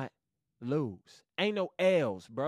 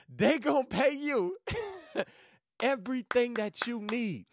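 hyped; 7 semitones higher than usual. Real, not acted. Hyped, laughing.

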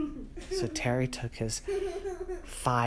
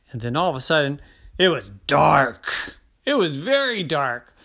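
slowing, louder reveal; dramatic build; 3 semitones higher, 7 dB louder than usual. A narrator's reading. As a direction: land the dark like a spooky reveal.

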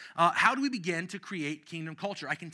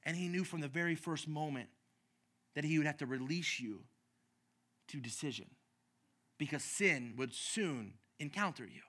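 hushed preaching; 2 semitones lower, 4 dB quieter than usual. Acted. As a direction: draw them in close with a soft voice.

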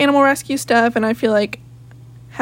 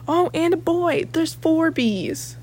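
surprised gasp; 6 semitones higher, 4 dB quieter, slower than usual. Real, not acted. Sudden realization about bees.

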